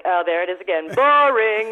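bored Queen. Retold or imitated. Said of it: "She's like".

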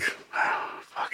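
soft whispered fuck after a sigh; whispered curse. Acted. At mom's deathbed, whispered defeated curse. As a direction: breathe it out, defeated.

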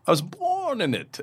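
lightly voiced movie villain; a hint of villain impression; Bane. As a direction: quote the villain with a touch of menace. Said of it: "as Bane would say".

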